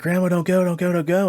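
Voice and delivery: high, loud, desperate begging; retold screaming plea. Dreamer calls after departing grandmother, high loud retold plea. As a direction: imitate screaming for someone to stay. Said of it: "screaming, like".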